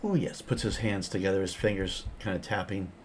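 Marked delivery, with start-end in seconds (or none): 0.0-0.4 quirky NPC voice. Acted.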